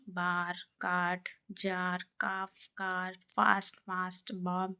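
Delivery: बार, काट, जार with slow, drawn-out 'aa'; long 'aa' demo. Shown as a demonstration. Teacher listing examples of the आ sound, बार and काट with long 'aa'. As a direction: stretch the 'aa' in each word from बार to फास्ट. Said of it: "ए के उच्चारण का आ की मात्रा".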